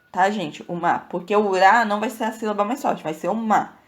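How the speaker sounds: ordinary delivery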